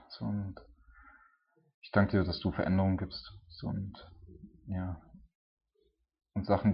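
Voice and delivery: earnest, mostly hushed prayer addressed to God; spoken prayer. Acted. Preacher leads the congregation in closing prayer, earnest and hushed.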